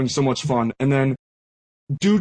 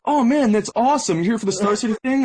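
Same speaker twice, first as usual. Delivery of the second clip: higher-pitched imitation of an eager fan; excited fan; 7 semitones higher than usual. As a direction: retell a fan's thrilled greeting. Second retold fan meets player.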